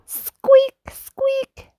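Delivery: sharp high-pitched squeak; a tiny squeaky-toy cry. Acted. Toy noises at the playground.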